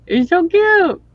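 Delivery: very high cutesy coo; gushing coo. Real, not acted. Streamer gushing over something cute, high coo.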